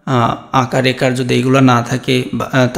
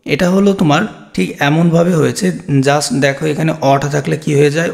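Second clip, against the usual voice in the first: ordinary talk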